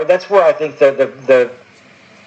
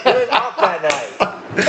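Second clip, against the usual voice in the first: laughing; 5 semitones higher, 5 dB quieter, slower than usual. Real, not acted.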